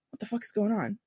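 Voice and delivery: stunned disbelief. Retold or imitated. Viewer reacting to a wild twist in the show, hushed stunned disbelief. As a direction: breathe it out, floored by the plot. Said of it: "you'll just be like".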